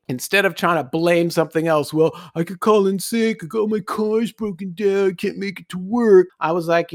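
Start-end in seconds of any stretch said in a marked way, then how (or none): 1.9-6.3 mock whine, slightly raised pitch; whiny excuse-making. Worker blaming a broken car for missing work, mock whining. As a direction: put on a feeble complainer's voice.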